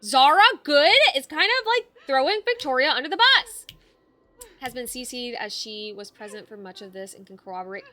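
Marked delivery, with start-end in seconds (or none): 0.0-3.7 loud, high outraged protest. Real, not acted. Streamer reacting to the betrayal, loud and high. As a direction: call it out, indignant.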